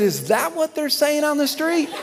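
high-pitched incredulous question; retold astonishment. Pastor answering his critic on the street, retold amazement. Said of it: "I couldn't be quiet at that point".

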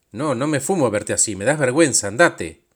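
loud, contemptuous brush-off; scornful and loud; angry dismissal. Acted. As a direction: push him away with a shouted rejection. Acted quarrel, harsh rejection.

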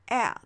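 エア as one long, wide 'æ'; American short-A sound. Shown as a demonstration. Teacher sums up the third point, wide American A. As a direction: spread エア wide, like the vowel in cat. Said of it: "エアという母音について".

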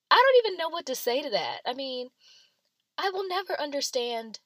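high, loud disbelief; can't believe it, raise your voice; exasperated disbelief. Real, not acted. Exasperated reaction.

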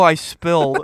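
a hint of a small child; meek little confession; childish confession. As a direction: kid confesses to Missus Gill. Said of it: "Hey, Missus Gill".

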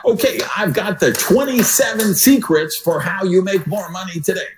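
high-pitched huckster voice; mock money-secrets sales patter; hype salesman pitch. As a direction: pitch it like a cheesy get-rich seminar speaker. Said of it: "the guy who says".

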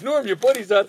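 teacher alerting student his friend escaped, calling out. Retold or imitated. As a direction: call out to the kid.

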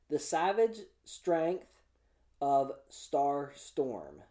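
slowly, word by word with pauses; careful slow title read, wary of a tongue twister. Real, not acted. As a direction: pick through each word slowly. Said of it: "I was afraid to say that too fast".

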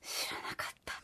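whispered, very soft admission; voiceless little aside; hushed confession. Real, not acted. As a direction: breathe it out in a whisper. Confesses not having known.

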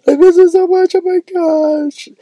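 high, whining ache for the pizza; whiny longing. Real, not acted. Vlogger pining for his favourite pizza, high and whining. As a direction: moan like you miss it badly. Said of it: "longing for the pizza".

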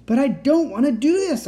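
high, loud panicked protest; screaming. A narrator's reading. Captive child refuses the experiment, screaming. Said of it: "I screamed".

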